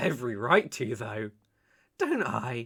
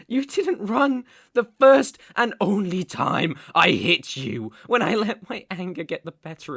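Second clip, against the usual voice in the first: loud, raised, emphatic voice; impassioned outburst; 4 semitones higher, 8 dB louder than usual. Acted. Man reminding lover she stayed, impassioned outburst. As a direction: push it out loud and high.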